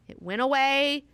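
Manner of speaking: bright emphatic cry of victory; triumphant. Real, not acted. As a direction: crow about the win. Triumphant repeat of the cure.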